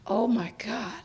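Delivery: awed whisper. Retold or imitated. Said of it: "I said".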